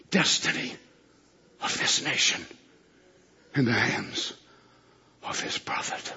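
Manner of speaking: fading to whisper. Real, not acted. Preacher fading his point to a whisper, slow and hushed. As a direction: let it sink to a breath.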